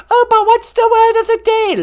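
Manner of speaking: high comic British granny; high-pitched royal curiosity; Queen Mum. Acted. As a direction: pipe up as a put-on old monarch. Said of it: "It's the Queen Mum".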